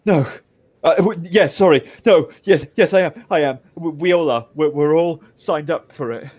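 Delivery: high, loud, stammering panic; flustered, frightened confession; terrified prisoner. Acted. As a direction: blurt it out, tripping over the words. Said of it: "so terrified".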